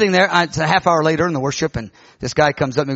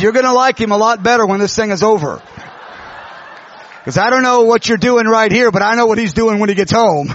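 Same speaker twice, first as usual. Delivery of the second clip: loud, high, comic insistence; emphatic retort; 6 semitones higher, 6 dB louder than usual. Retold or imitated. Preacher tells the wife about her husband's demon, loudly insistent. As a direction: belt it out for laughs.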